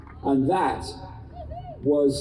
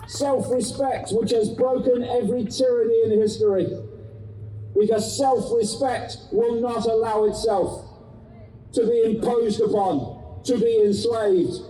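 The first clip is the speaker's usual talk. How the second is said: urgent activist; 6 semitones higher than usual. Acted. Rousing speech to crowd.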